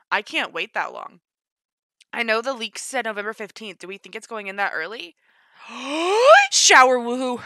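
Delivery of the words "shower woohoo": loud gasp, then high exclamation; shocked excitement. Real, not acted. Spotting shower woohoo in trailer, shocked excitement. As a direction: gasp and blurt it out.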